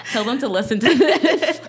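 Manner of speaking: high-pitched, through laughter; laughing. Real, not acted. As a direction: say it while cracking up. Both friends cracking up.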